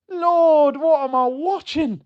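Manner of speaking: high, loud cry of disbelief; Lord, half-shouted high in real despair; exasperated cry. Real, not acted. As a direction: throw up your hands and cry out at the pitch. Exasperated at the match.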